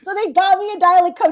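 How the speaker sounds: high-pitched, laughing delivery. Real, not acted. Laughing over the dialect coach story.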